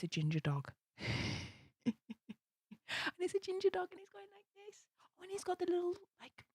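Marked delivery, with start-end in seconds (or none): 3.1-6.4 very high, cooing squeal; gushing over cuteness. Real, not acted. Streamer describes ginger karate dog sticker, gushing. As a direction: melt over something adorable, pitched way up.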